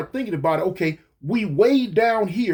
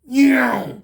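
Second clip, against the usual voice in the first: loud, higher vroom of a car taking off; imitated car zoom; 4 semitones higher, 5 dB louder than usual. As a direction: voice a car speeding away.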